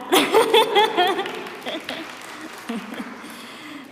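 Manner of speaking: high, loud burst of laughter; real hearty laugh. Real, not acted. Introducer breaking off her speech, laughing out loud.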